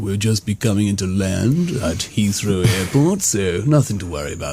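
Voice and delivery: captain reassuring passengers, low and unhurried; calm captain announcement. Retold or imitated. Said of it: "he said".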